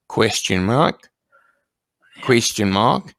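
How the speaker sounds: Australian rising question tune on "Question mark?"; mock Australian. Shown as a demonstration. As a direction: lilt up at the end the Australian way. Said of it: "if you wanna make it an Australian one".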